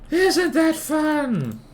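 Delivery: very high, slow mock-cheery drawl; sarcastic. Shown as a demonstration. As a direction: sing it out in dripping sarcasm. Repeated sarcastic question.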